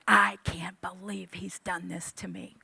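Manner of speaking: muttered under her breath; unvoiced angry whisper; whispered grumble. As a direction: hiss it to yourself, fuming. Muttering alone while pacing.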